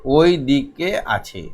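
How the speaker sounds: spaced-out, clearly pronounced phrases; clear careful reading. Shown as a demonstration. Teacher reading the primer aloud for young pupils, clearly enunciated. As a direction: read it out slowly, pausing between names. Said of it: "খুব সুন্দর করে স্পষ্ট উচ্চারণ করে".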